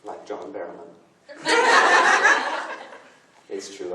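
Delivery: ordinary delivery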